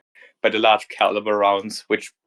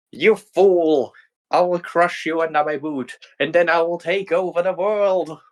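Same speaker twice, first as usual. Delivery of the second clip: raised, grand evil threat; threatening villain queen; 7 semitones higher than usual. Acted. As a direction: loom over the fool.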